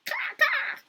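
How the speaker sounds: high shouted caw caw; crow caw. Acted. The crow returning to Gerda with a loud high caw. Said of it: "he said".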